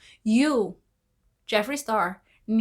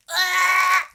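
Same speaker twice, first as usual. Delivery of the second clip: excited yelp; 9 semitones higher, 5 dB louder than usual. Real, not acted. Excited cry at the scene.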